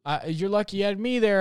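lightly put-on drunk voice; drunk woman. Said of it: "she was, like, drunk".

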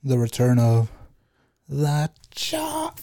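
reaching too high, voice breaks; voice cracking. Real, not acted. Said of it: "My voice just cracked".

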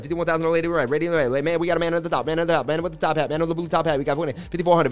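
rapid-fire auctioneer chant. Pretend. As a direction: rattle off bids like an auctioneer. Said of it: "I just had to do that".

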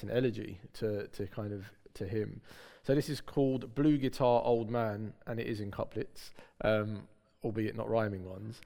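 ordinary delivery

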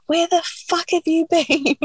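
high-pitched, incredulous demand; retold exasperated outburst. Labouring woman greeting Georgia at last, high exasperated outburst.

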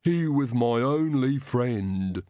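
slow, lisping henchman in grief; drawn-out lament of a lisping servant; grieving Igor. Acted. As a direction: mourn it slowly with a heavy lisp. Igor grieves over his dead dog.

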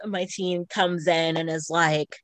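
normal talking voice

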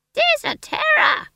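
very high, loud, slow crone; stage crone. A narrator's reading. Old woman's line in a play, shrieked high and slow. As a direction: screech it like a hammy old crone.

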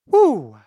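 loud high woo; pick-me-up whoop. Real, not acted. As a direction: whoop to shake off the gloom. Snaps herself out of gloom.

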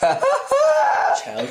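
high squealing laugh on the word; laughing shriek. Real, not acted. Laughing outburst at friend.

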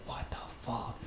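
hushed, whispered reaction to a shocking sight; stunned whisper. Retold or imitated. Onlooker whispering to himself at a train wreck, stunned whisper. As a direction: whisper it in disbelief.

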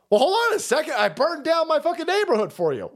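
high, outraged mock complaint; mock indignant protest. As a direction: squeal it like a betrayed supporter.

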